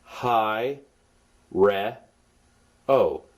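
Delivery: "hi, re, o." said as three separate syllables; syllable-by-syllable pronunciation. Shown as a demonstration. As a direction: sound out "hi, re, o." with a clear gap after each. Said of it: "This word is pronounced".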